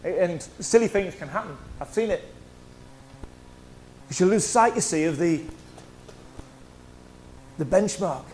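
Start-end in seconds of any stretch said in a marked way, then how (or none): none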